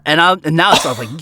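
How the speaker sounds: ordinary talk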